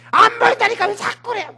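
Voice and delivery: angry retort. Acted. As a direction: throw it back, fed up. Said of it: "성질을 내면서". (사환이 엘리야에게 짜증 내며 하는 말)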